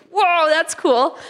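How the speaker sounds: high, stretched-out wonder; acted amazement. Retelling amazement at the game.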